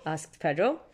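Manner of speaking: ordinary delivery, nothing marked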